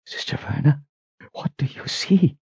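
soft, breath-only frightened whisper; shaky whisper. A narrator's reading. Richard asking the Sister what she sees, shaky whisper. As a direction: whisper it shakily, barely voiced. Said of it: "came in a shaky whisper".

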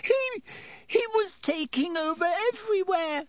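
very high, stammered panic; gasped. A narrator's reading. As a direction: gasp out a high frightened excuse. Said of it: "gasped Pettigrew".

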